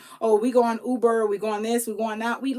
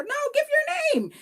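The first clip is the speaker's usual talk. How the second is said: squeaky insistence. Retold or imitated. Cashier insisting on name.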